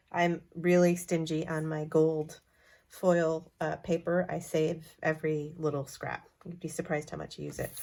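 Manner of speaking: ordinary talk, nothing marked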